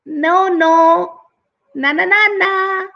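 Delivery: high singsong teasing; high, cheeky monkey jeer; taunting monkey. A narrator's reading. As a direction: tease in a loud playground chant. Monkey taunting the angry tiger.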